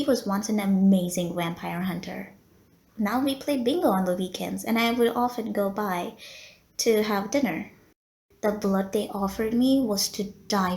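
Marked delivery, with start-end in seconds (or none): none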